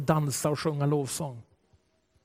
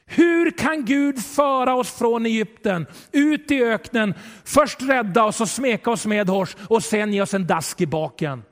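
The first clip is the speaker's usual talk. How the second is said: ordinary delivery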